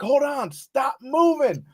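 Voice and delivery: high shouted warning, slow on stop moving; urgent shout. Retold or imitated. Friend warning him about the barbed wire, shouting. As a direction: yell at him to freeze.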